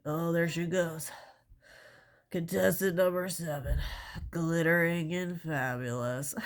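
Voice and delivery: slow, grand pageant announcer with a stuffy nose. Pretend. Pretend pageant announcer introducing a contestant, slow and grand. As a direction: present contestant seven like a showman. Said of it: "like, an announcer at a pageant".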